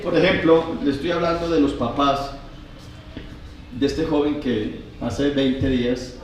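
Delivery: usual speaking voice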